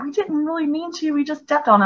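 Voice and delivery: put-on a high, soft whiny kid's plea; mock crying kids. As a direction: whimper it like a caught child. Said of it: "they were crying, like".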